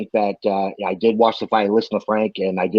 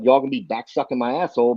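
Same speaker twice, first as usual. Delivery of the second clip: normal talking voice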